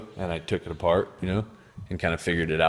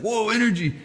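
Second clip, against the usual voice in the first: high, thrilled cry of a spark coming alive; excited spark voice; 14 semitones higher, 4 dB louder than usual. Pretend. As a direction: squeal it like electricity waking up. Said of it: "and then it's like".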